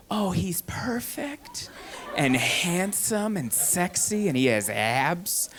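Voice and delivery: slow, dreamy imitation of smitten women; mock swooning. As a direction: swoon softly over a movie hunk, hammed up. Mocking women swooning over film hero.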